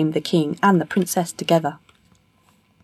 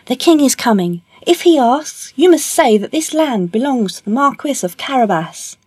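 high, raised calling voice; shouting; 7 semitones higher, 4 dB louder than usual. A narrator's reading. Puss warning men making hay, shouting. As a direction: call out urgently across a field. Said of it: "he shouted to them".

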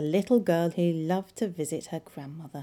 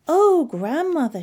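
high, loud exclamation of surprise; loud storybook shock, pitched way up; startled cry; 9 semitones higher, 9 dB louder than usual. A narrator's reading. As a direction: cry out, astonished. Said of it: "she cried".

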